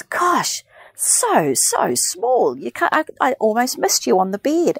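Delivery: high, exaggerated nurse marvelling; amazed nurse. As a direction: ham up a gushing nurse. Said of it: "And she goes".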